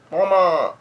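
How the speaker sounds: high, drawn-out mock yell; imitated cry for Mama. Brule calling to Mama, hammed-up high yell. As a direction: call out for mother in a put-on voice. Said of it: "Brule yells out".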